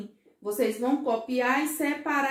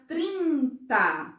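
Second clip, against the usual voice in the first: "trinta" slowly, as trin, then ta; teacherly "trinta" in two long beats; syllables stretched out; 3 semitones higher than usual. Shown as a demonstration. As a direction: draw out both syllables of "trinta". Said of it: "As sílabas, quando eu falo elas".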